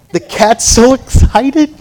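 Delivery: drawn-out, high mock glee; mock excitement. Preacher mocks cat owners' bath-time hopes, mock excitement. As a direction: gush loudly, stretching it out.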